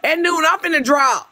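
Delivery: high, overwhelmed pleading; emotional. Real, not acted. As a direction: plead with them to stop before you well up. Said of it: "Now y'all making me emotional".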